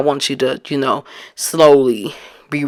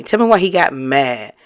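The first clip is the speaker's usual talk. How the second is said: ordinary talk, nothing marked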